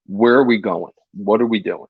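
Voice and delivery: usual speaking voice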